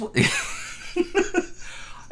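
squealing high breathy laugh; high giggle. Real, not acted. Caught off guard by her friend's jab, squealing laugh.

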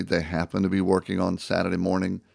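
ordinary delivery

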